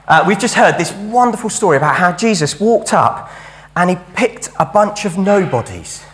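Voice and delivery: ordinary delivery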